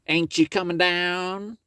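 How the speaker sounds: sweet-talking the folks as the fox; high sly fox voice. Acted. Brer Fox greeting the folks from the door, high sly voice.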